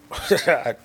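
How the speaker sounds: real short breathy chuckle; laughing. Real, not acted. Speaker cracking up at a voice in his head, laughing. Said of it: "I'm laughing because".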